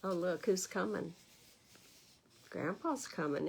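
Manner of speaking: ordinary delivery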